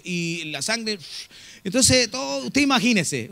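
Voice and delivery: ordinary talk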